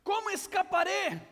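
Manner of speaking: put-on frantic child yelling for his father; child calling out. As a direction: shout for dad through the smoke. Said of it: "empieza a gritar de regreso".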